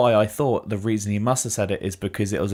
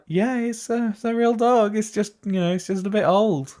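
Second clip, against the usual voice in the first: high mock voice of owner; 8 semitones higher than usual. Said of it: "And she's like".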